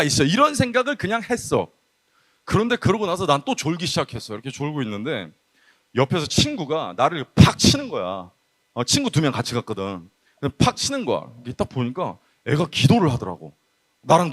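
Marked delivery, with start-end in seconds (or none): none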